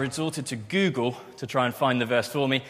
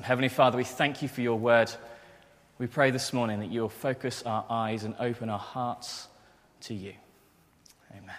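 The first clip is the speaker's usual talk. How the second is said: soft, hushed prayer voice; quiet prayer before the talk; 2 semitones lower, 3 dB quieter than usual. Real, not acted. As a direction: pray gently to God. Opening prayer.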